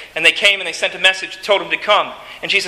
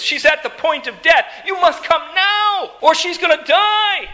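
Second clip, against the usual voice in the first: panicked pleading; 10 semitones higher than usual. Acted. Jairus begging Jesus to save his daughter, panicked. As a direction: act out a desperate, high-pitched appeal. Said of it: "has come to him in a panic".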